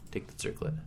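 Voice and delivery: soft unvoiced prompt; whispered instruction. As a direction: whisper the option to the player.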